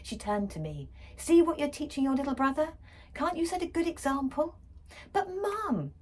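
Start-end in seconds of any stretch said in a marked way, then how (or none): 1.2-4.5 higher, louder, fast reproach; exasperated mother scolding; mum scolding. Acted. 5.1-5.9 high whiny protest; frustrated girl protesting. Acted.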